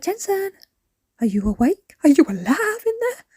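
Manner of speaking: high, loud call to wake him; mother calling to wake her son in the car. Real, not acted. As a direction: call his name up high to rouse him. Calling to a sleeping child.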